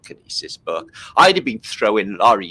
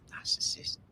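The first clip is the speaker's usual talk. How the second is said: whispered aside; 16 dB quieter than usual. Real, not acted. Host whispering a verdict on mummy. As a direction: breathe it as a sly aside.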